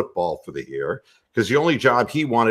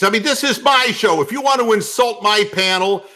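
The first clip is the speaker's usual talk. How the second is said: put-on higher-pitched, indignant New York accent; New York guy; 11 semitones higher, 3 dB louder than usual. Host defending his panel, indignant New York accent. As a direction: snap back as an offended New York guy.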